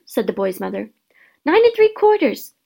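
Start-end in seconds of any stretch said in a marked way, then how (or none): 1.4-2.5 put-on high piping little girl; piped small girl. A narrator's reading. Little sister answering her mother, high and piping. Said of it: "piped a small girl".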